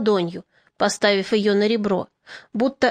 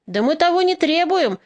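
higher, mildly raised protest; shouted objection; 6 semitones higher than usual. A narrator's reading. Peasant objecting to the resolution, mild shout. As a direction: call out an objection from the crowd. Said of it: "крикнул кто-то из мужиков".

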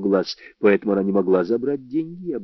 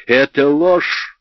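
raised, drawn-out cry of denial; 6 semitones higher, 4 dB louder, much slower than usual. A narrator's reading. Netta cries out at the narrator's accusation, crying out. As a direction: cry out, higher and slower. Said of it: "выкрикнула она".

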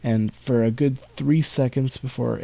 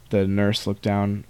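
usual speaking voice

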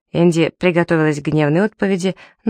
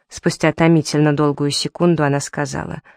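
plain reading voice

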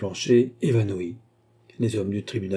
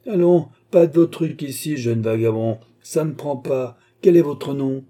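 raised, scornful magistrate's voice; sneering; 5 semitones higher, 6 dB louder than usual. A narrator's reading. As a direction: sneer at the accused and demand an answer. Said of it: "reprit mister Fogg en ricanant". (en ricanant, avec mépris)

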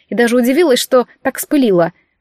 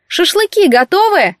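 higher, louder and slow call across the yard; mother-in-law calling from the porch, high and loud; 8 semitones higher, 4 dB louder, slower than usual. A narrator's reading. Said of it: "раздался голос Тамары Владимировны от крыльца".

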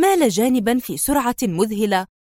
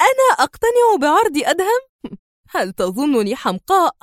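high, incredulous scoff with small laughs; sarcastic; 6 semitones higher than usual. A narrator's reading. Sonia laughing off David's assumption, scornful. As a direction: ridicule the very idea, starting high. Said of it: "ابتسمت في سخرية وقالت".

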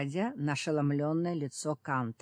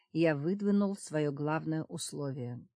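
plain reading voice

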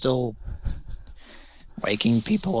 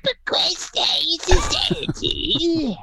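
much higher falsetto rock singer voice; screechy singer impression; 3 dB louder than usual. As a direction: screech it like a hard rock frontman. Falsetto [name] impression.

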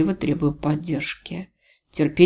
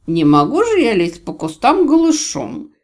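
put-on loud, high drunken giggle; giggly drunk; 5 semitones higher, 6 dB louder than usual. A narrator's reading. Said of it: "пьяно хихикнул Аджей".

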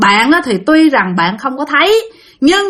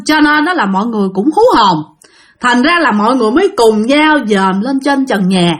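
ordinary talk, nothing marked

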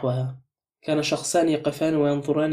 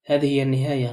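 plain reading voice

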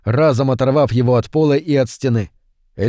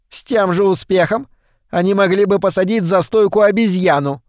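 put-on old wife chiming in; 7 semitones higher than usual. A narrator's reading. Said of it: "подхватила миссис Шефер".

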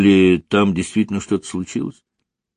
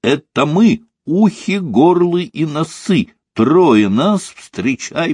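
high-pitched playful announcement; merry and excited; 7 semitones higher, slower than usual. A narrator's reading. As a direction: announce yourself merrily in a raised pitch. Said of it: "Весёлый и возбуждённый".

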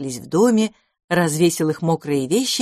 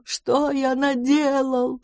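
причитание выше и очень медленно; рыдать, растягивая каждое слово; рыдая; 7 semitones higher, much slower than usual. A narrator's reading. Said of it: "громко зарыдал". (рыдающее причитание, выше)

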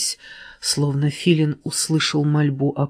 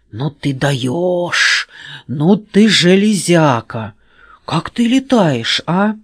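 amazed; 3 semitones higher, 5 dB louder, slower than usual. A narrator's reading. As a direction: marvel at the flying. Amazed friend exclaims.

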